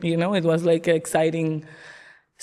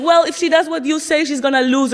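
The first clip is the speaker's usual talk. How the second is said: raised, higher voice arguing her point; mom in argument; 7 semitones higher, 4 dB louder than usual. Retold or imitated. Mom arguing with dad about the sandwich, heated raised voice. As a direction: quote mom's heated comeback, a bit louder. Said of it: "my mom was telling my dad".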